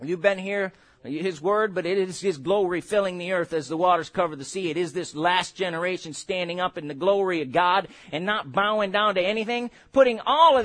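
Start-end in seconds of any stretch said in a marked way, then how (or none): none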